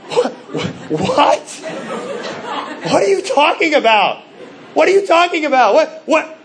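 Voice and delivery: a father floored by what his son just said; mock-shocked what are you talking about; shocked disbelief. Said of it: "I was so shocked".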